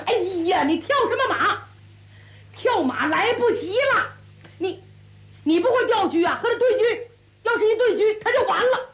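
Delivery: high-pitched, worked-up advice; urgent kibitzing. A narrator's reading. Onlooker butts in on a losing player, urgent and high.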